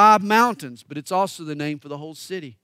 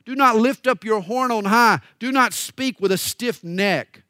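forceful scripture reading; 4 semitones higher, 6 dB louder than usual. Acted. Reads Psalm aloud forcefully.

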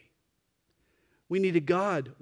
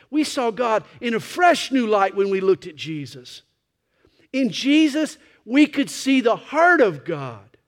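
rising, louder preacher's cry; preaching; 6 semitones higher, 9 dB louder than usual. Acted. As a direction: build the sermon to a peak. Preacher builds to full force.

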